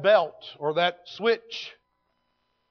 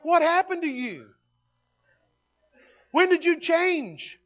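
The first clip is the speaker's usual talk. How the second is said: mock disbelief; 9 semitones higher, slower than usual. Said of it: "I'm like".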